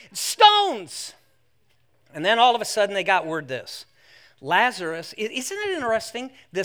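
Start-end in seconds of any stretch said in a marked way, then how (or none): 0.1-1.1 high, loud mock-horrified cry; alarmed exclamation. Punchline of acted disciples' alarm.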